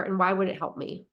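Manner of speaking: ordinary talk, nothing marked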